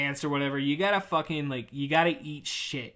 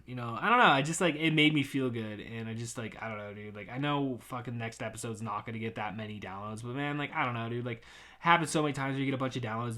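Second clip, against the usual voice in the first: ordinary talk, nothing marked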